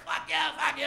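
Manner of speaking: high, loud mock heckler yells; crowd heckling. Punk crowd cursing the comic as he leaves, acted-out yelling. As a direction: hurl it like an angry audience.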